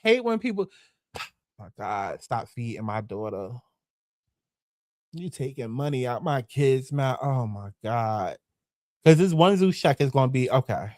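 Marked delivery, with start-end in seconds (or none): 1.1-8.4 lower, much softer mock lament; mock whining. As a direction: parody her pitiful moaning. Mocking Jocelyn's complaint.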